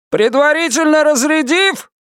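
high, urgent voice through static; over the radio. A narrator's reading. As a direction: push the words through a crackly radio. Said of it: "Голос его был невыразительным и далёким".

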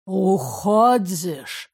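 put-on drunk, slurring the word; slow drunken slur; slurred drunk. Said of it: "Заплетающимся языком еле внятно спросил".

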